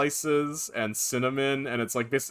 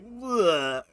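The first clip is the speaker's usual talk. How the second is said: disgusted blech; 7 semitones higher than usual. Retold or imitated. Disgusted at the pizza.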